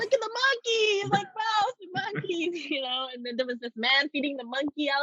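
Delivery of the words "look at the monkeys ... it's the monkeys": high gushing squeal at cute monkeys; mock excited tourist. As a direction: play the starry-eyed tourist, pitched way up.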